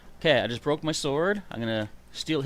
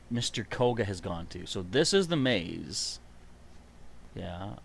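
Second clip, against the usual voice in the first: normal talking voice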